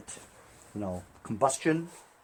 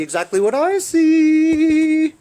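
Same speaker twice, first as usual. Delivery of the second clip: excited; 14 dB louder, slower than usual. Real, not acted. Excited showing of damage.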